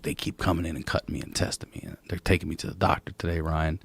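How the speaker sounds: soft, quick, low paranoid murmur; hushed confiding. Retold or imitated. Cousin secretly warning Ryan about doctors, hushed.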